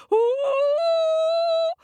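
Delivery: very high frightened ooh; scared monkey squeal. Acted. Monkey facing the dogs, high scared squeal.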